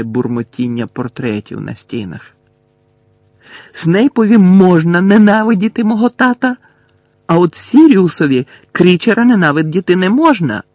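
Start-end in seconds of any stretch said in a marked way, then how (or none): 3.7-10.6 high, loud, outraged shout; full-voiced furious outburst. A narrator's reading. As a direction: yell the injustice out. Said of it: "закричав Гаррі".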